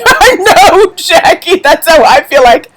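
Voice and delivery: high, laughing outburst; laughing agreement. Real, not acted. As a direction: agree through a burst of laughter. Laughing reply to viewer's comment.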